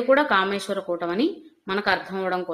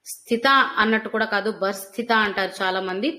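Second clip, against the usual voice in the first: ordinary talk, nothing marked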